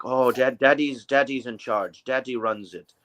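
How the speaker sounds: ordinary talk, nothing marked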